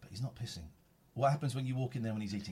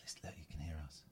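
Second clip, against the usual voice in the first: fast hushed whisper; 7 semitones lower, 12 dB quieter, faster than usual. Real, not acted. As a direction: whisper so the cat stays calm. Quiet near the cat.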